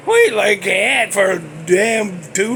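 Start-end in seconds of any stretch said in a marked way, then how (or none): 0.1-0.3 loud, high pig squeal; mock pig squeal, long and piercing; Ned Beatty's squeal. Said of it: "poor Ned Beatty was like".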